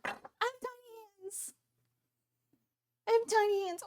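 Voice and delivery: very high, small playful voice; tiny squeaky voice. Pretend. Streamer joking about small hands, tiny squeaky voice.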